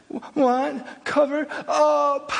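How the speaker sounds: put-on high, slow little toddler voice; tiny child asking for her blanket; imitating toddler. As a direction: whimper it like a sleepy two-year-old. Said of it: "And she says".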